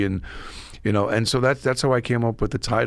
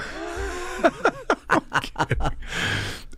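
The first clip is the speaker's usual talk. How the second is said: high breathy laugh; 4 dB quieter than usual. Real, not acted. Laughs at his own joke.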